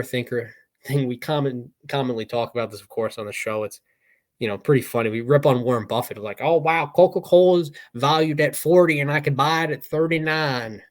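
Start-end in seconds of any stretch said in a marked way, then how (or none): 6.4-10.8 higher, louder mock Nebraskan accent; imitating a Nebraskan accent. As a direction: ham up a folksy Nebraskan accent, teasing Warren Buffett. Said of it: "That's my Nebraskan accent".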